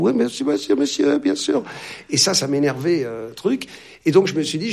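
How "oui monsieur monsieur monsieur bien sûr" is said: high, bowing yes-sir voice; servile waiter. Retold or imitated. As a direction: play the obsequious servant.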